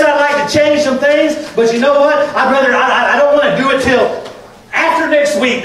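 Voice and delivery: loud, high-pitched pulpit shout; fired-up sermon delivery, ringing out; full-force preaching. Real, not acted. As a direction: preach it at the top of your voice.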